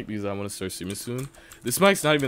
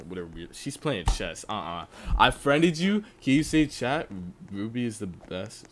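ordinary delivery

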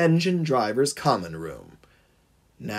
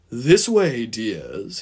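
slimy imploring; 2 semitones lower, much slower than usual. A narrator's reading. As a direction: coax them smarmily, dropping on the last word. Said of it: "he implored them slimily".

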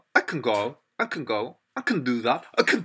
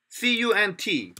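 letter-by-letter, higher pitch; spelling out; 5 semitones higher than usual. Shown as a demonstration. Teacher spelling the word for learners, spelling out.

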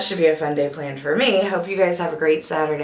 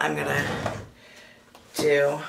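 ordinary delivery

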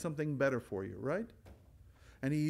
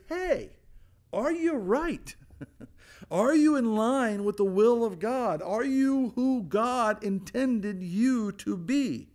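high, loud probing questions; voicing the Word of God; 4 semitones higher, 4 dB louder, slower than usual. Preacher voicing Scripture probing the congregation, high and loud. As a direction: challenge them like a searching voice.

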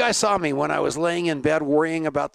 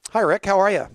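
excited greeting; 6 semitones higher, 5 dB louder than usual. Real, not acted. Greeting new guest on air.

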